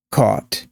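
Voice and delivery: "caught" with rounded AW, falling; caught, AW vowel. Shown as a demonstration. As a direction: say "caught" as kawt, tune down. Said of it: "repeating four or five times".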